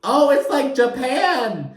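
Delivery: high, slow, airy mimic of Lisa; a light send-up of a clueless cast member; lightly mocked Lisa voice; mimicking Lisa. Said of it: "The first thing out of Lisa's mouth is".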